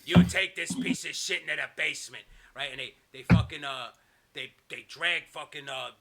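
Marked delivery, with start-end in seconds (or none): 0.1-2.2 loud mock villain command; lightly voiced boss ordering henchmen; a touch of menace; Kevin Sullivan. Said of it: "turns to the Dungeon of Doom and he goes".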